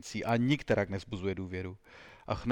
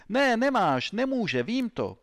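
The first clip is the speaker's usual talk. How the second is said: loud, high-pitched interrogator's denial; emphatic accusation; 11 semitones higher, 6 dB louder, slower than usual. Acted. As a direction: shoot down the alibi forcefully. Interrogator cuts down suspect's alibi.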